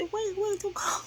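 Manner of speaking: high, urgent wait-wait call; pleading call. Real, not acted. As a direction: call her back before she escapes. Calling after a fleeing bug.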